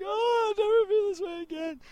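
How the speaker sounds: high, melodramatic self-mocking wail; mock sad wail. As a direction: wail it high like overdone heartbreak. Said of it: "like they're so sad about the song".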